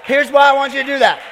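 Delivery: loud, high shout over applause; real shouted announcement; calling out. Real, not acted. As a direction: raise the voice to win back the room. Addressing crowd over applause.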